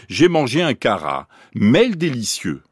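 cara, then a break, mêle begun as a new phrase; word broken in two. Shown as a demonstration. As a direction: split caramel wrongly between cara and mêle. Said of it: "découpé en deux morceaux par la mélodie". (coupure mélodique entre cara et mêle)

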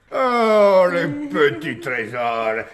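high loud coo; doting coo. Acted. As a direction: melt over the little ones. Doting over the children.

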